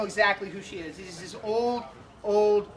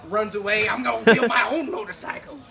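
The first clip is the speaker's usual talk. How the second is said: louder mock old lady voice; loud pretend granny voice; 7 dB louder than usual. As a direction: play the helmet-thief granny. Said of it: "She's gonna, like".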